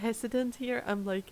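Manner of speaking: ordinary talk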